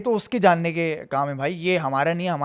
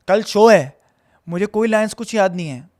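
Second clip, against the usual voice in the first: louder, higher burst of alarm; alarmed outburst; 4 semitones higher, 8 dB louder than usual. Retold or imitated. Actor suddenly given the lead for tomorrow's show, alarmed. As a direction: blurt it out in disbelief, raised voice.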